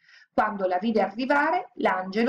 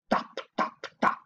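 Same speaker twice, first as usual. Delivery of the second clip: whispered footsteps; much slower than usual. A narrator's reading. Footsteps following.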